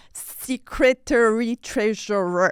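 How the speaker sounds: secretary as see-CRE-tary, slow, then treasurer; misplaced emphasis. Shown as a demonstration. Learner naming the networking role, slow misstressed secretary treasurer. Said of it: "or I am the secretary".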